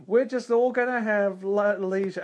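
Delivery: high, slow dreamy voice; dreamy Star Trek idealist. Retold or imitated. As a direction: float the words like a utopian dreamer. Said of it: "very Star Trek future".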